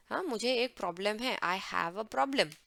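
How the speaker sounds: ordinary delivery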